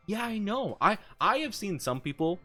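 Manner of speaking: ordinary talk, nothing marked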